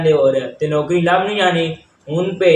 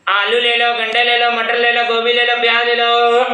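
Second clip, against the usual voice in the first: high sing-song hawker's cry; melodic vegetable vendor call; 5 semitones higher, 3 dB louder than usual. Pretend. Vegetable seller calling out wares.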